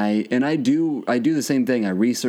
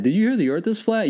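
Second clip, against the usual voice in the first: much higher, gossipy question; mock gossiping voice; 11 semitones higher than usual. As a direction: play a breathless gossip spreading news. Imitating people gossiping.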